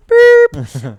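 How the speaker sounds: long drawn-out loud "boop"; held electronic-style beep by mouth; censor bleep. Pretend. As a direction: imitate a bleep hiding a word. Bleeps out secret spot name.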